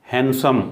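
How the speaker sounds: हैंडसम as "han-sum", no d; silent D. Shown as a demonstration. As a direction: leave the d out of हैंडसम. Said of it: "बल्कि हैंडसम". (हैंडसम में डी silent)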